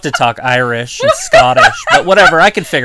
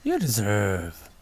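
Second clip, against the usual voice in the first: slow mock promo; 5 semitones lower, 10 dB quieter, much slower than usual. Imitating wrestler's promo.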